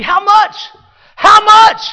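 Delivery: high, loud, rising shouted question; escalating cry. Real, not acted. Sermon building cry.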